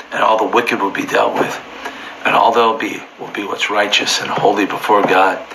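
normal talking voice

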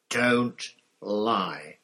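loud, forceful rebuke; bellow. A narrator's reading. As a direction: cut off the liar with a bellow.

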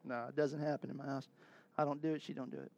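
ordinary talk

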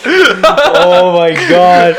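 long, high, loud gasp through laughter; laughing. Real, not acted. Guest cracking up at the host's nonsense song, laughing. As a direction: drag it out while cracking up.

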